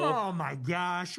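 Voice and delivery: drawn-out, low oh my gosh; slow stunned. Real, not acted. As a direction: let it sink in slowly.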